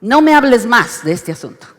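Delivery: loud, stern final word; angry rebuke. Shown as a demonstration. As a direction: shut the subject down, angrily. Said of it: "cuando Dios se enoja". ("No me hables más" con enojo, fuerte)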